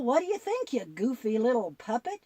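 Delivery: usual speaking voice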